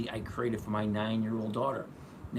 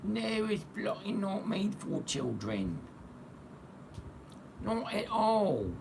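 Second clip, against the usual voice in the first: hammed-up English accent, very high and slow; 10 semitones higher, slower than usual. Streamer insisting it's not for children, hammed-up English accent. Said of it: "I always get that English accent".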